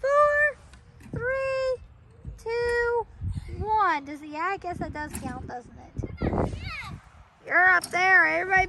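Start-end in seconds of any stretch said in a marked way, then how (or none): 0.0-4.1 game countdown call. Acted. Calls the countdown again.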